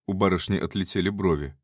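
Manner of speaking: usual speaking voice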